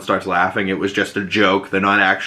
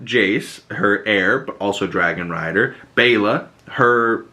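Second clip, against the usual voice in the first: normal talking voice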